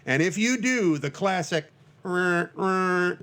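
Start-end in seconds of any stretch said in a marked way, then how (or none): 2.0-3.2 mock truck horn blast, rolled r's; trucker horn imitation. Host showing listeners the horn signal, horn imitation. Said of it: "trucker horn sign".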